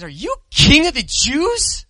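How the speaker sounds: high, sneering soldier's taunt; a touch of sarcastic mockery; mocking. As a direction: toss the question out in scorn. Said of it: "we mocked him with sarcastic salutes".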